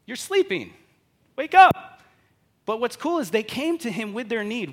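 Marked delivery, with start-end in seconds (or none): 0.0-2.0 high, loud panicked cries building to a yell; frantic, shouting over the storm to wake him; panicked disciples. Retold or imitated. Disciples yelling at sleeping Jesus in the storm, panicked. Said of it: "It's like".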